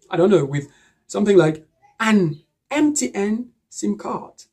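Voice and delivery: ordinary talk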